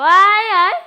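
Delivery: high loud panicked yelp; panicked cry. Real, not acted. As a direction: yelp in alarm.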